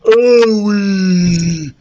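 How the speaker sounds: loud, high, drawn-out moan; pained ogre. A narrator's reading. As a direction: howl it woozily. Ogre stirs in pain.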